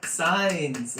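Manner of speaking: high, loud, delighted outburst; bursting with real joy at the signs; overjoyed. Real, not acted. Reader thrilled by matching signs, overjoyed high and loud. Joyful reaction to a reading.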